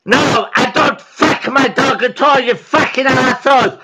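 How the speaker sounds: usual speaking voice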